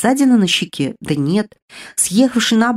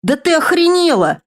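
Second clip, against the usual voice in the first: higher, sharp outraged yelp on waking; indignant exclamation; 9 semitones higher, 3 dB louder than usual. Acted. Girl slapped awake yelling at her sister, outraged exclamation. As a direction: jolt awake and snap at the slap. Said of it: "воскликнула я".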